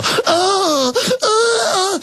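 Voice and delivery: very high, crying fake-scared oh; mock frightened whimper. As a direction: whimper like a hammed-up scared voter. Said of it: "They go".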